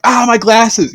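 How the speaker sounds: high-pitched imagined cry of alarm; mock scream. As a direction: imitate a mom shrieking on the drop. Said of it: "I just assume your mom screams".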